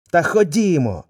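coaxing wolf. Acted. Wolf urges the scared lion to go, loud coaxing. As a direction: egg him on brightly. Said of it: "заохочував вовк".

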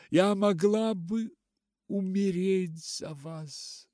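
loud, high, anguished cry; sobbing outburst. A narrator's reading. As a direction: cry it out through sobs, pitched high. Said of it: "всхлипнула она". (громко, надрывно, всхлипывая)

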